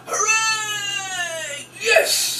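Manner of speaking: high, hammed-up victory squeal; squeaky mock triumph, drawn out; gloating triumph. Shown as a demonstration. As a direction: crow over the kids like a fake winner. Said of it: "So you go".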